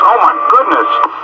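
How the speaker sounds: ordinary talk, nothing marked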